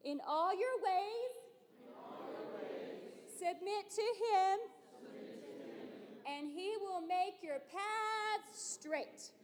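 very soft, high, slow recitation; near-whispered classroom call, pitched high; hushed. Shown as a demonstration. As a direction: drop right down and draw each phrase out. Leads last part of verse hushed.

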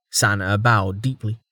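plain reading voice

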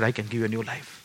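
low, hushed divine promise; God's voice. Retold or imitated. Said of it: "God said".

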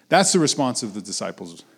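normal talking voice

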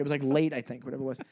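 ordinary talk, nothing marked

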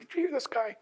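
hushed, indignant griping behind someone's back; half-whispered complaint; grumbling rumblings. Acted. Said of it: "almost hear the rumblings".

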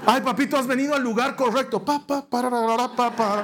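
hammed-up circus host; mock entertainer. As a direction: play the eager clown dad. Eager entertainer parent.

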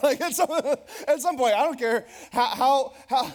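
loud, high, laughing stammer; real laughter breaking up the sentence; laughing through words. Real, not acted. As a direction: crack up while trying to get the point out. Cracking up at own gravity joke.

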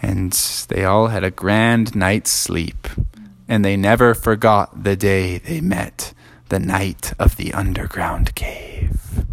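fading to a whisper for bedtime; sleepy story ending. Acted. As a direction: wind down to sleep, whispering the last words.

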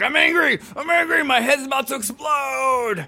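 high, slow sung shout of anger; comic fury, half sung; mock anger. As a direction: belt it like an angry rock song. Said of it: "you're like".

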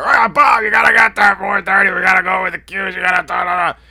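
high, very loud frantic shouting; hammed-up yelling promoter; Pat impression. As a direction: bellow it in a panic, running into gibberish. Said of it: "he starts doing a Pat".

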